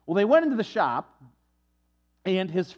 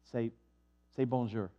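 much softer, low prompt; hushed aside; 8 semitones lower, 8 dB quieter than usual. Retold or imitated. Professor quietly prompts his friend to greet first. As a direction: coach him under your breath.

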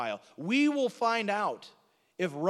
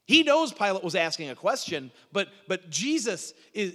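normal talking voice

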